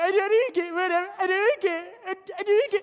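high, blubbering fake sobs; mock crying. Teen girl crying at her mother, hammed-up sobbing. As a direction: sob it out like a hysterical teen. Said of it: "then you start crying".

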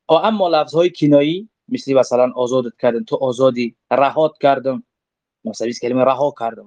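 ordinary talk

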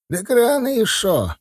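put-on high, slow old man's protest; objecting old man. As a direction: object like a village grandad, pitched high. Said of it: "возразил дед". (дед возражает, высоко и протяжно)

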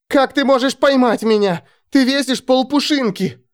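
high indignant shouts; shouting over wind. Acted. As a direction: shout back in disbelief. Alex protests loudly.

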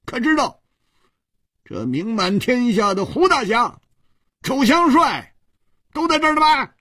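red-faced, push back hard; a flustered man defending his hosts, high and strained; indignant outburst. Acted. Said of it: "涨红了脸说".

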